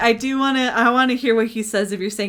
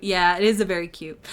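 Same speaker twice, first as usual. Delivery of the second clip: normal talking voice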